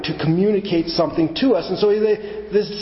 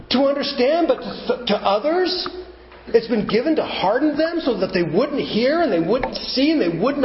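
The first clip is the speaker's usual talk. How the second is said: incredulous; 8 semitones higher than usual. Acted.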